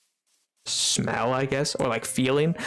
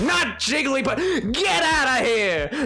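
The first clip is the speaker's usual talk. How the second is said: shooing away; 10 semitones higher, slower than usual. Acted. Streamer mock-shooing Jigglypuff from her Pokemon favorites. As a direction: chase the lamb off in mock outrage.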